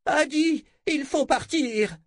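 high, urgent shout for Adi. Acted. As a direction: yell over the chaos. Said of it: "il hurla".

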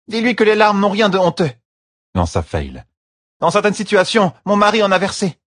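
Fail tells them tears are no shame; Fail speaking. A narrator's reading. Fail answering. (Fail, plus aiguë, rassurante)

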